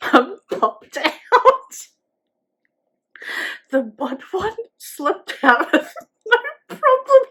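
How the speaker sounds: real giggles breaking up every phrase; struggling to speak through laughter; laughing. Real, not acted. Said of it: "because I can't stop laughing".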